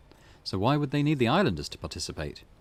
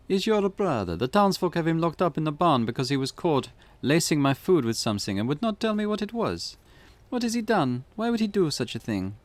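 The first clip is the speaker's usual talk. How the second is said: higher, louder mock Eastern European accent; an upset wife in a put-on Eastern European accent; hammy Eastern European accent; 7 semitones higher, 4 dB louder than usual. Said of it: "my Eastern European accent".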